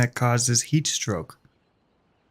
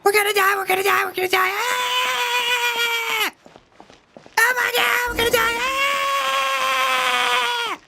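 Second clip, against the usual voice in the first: high shrill panicked screaming; mock terror, squealing high; screaming like a girl. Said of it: "start screaming like a girl".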